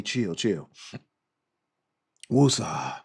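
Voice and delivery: low self-soothing mantra; easing down from being fired up; calming down. Real, not acted. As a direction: settle yourself, letting the pitch sink. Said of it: "So let me chill".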